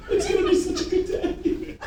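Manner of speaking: very high, squeaky fake-tearful voice; mock emotional breakdown. Salesman given an easy sale, hammed-up tearful joy. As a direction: squeal it through pretend happy tears. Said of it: "break down emotionally and go".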